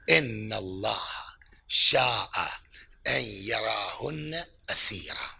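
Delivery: drawn-out Arabic recitation, word by word; slow solemn recitation. Acted. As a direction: intone each word slowly like scripture. Imam's words recited in Arabic.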